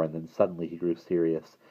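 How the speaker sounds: plain reading voice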